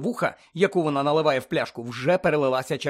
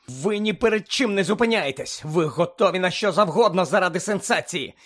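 high, heated accusation building louder; furious tirade; 5 semitones higher, 5 dB louder than usual. A narrator's reading. Hermione denounces the reporter, furiously. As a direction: build the anger to a loud high finish. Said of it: "люто проказала вона".

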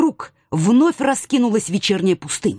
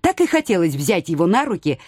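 ordinary delivery

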